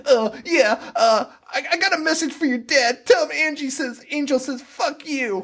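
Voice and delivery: high-pitched imitation of a frantic screamer; toned-down mock scream. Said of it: "She's screaming at the top".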